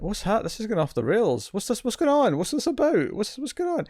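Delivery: rising pitch, baffled questions; bewildered. Retold or imitated. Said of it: "And I went".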